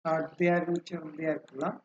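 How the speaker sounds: ordinary delivery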